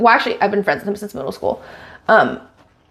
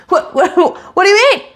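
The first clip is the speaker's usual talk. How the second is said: incredulous fan; 8 semitones higher, slower than usual. Retold or imitated. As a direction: play the stunned fan. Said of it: "I'm like".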